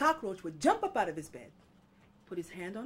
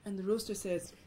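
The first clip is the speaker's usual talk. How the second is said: plain reading voice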